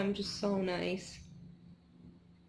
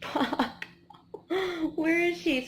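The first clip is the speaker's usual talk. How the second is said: frantic search; 10 semitones higher, 4 dB louder, slower than usual. Retold or imitated. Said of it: "And I was like".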